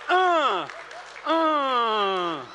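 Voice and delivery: loud, high, drawn-out "uh"; long hug groan. Retold or imitated. Preacher acts out hugging a stranger, loud drawn-out groan. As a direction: groan it out like a big squeezing hug.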